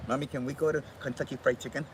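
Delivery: fast little chick voice; the chick begging its mommy, rushed; quick chick asking mommy. Pretend. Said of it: "the little chick says".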